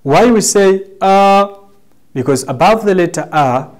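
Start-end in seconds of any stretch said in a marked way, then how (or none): 1.0-1.5 long, level "Uh". Shown as a demonstration. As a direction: hold "Uh" steady on one raised pitch. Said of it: "guides us to pronounce it in long".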